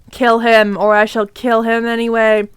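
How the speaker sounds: sinister overlord voice; evil villain. Acted. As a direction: threaten in a low villain monotone. Villain's threat, voice resumed.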